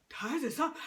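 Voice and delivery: ordinary delivery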